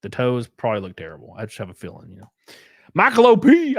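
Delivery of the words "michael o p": high, loud donor shout-out; announcing a donor. Real, not acted. Streamer hails a donor by name, high announcer shout.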